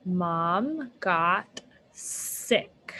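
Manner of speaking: very slow, word by word; slow blending. Shown as a demonstration. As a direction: blend the words very slowly. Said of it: "G-ot".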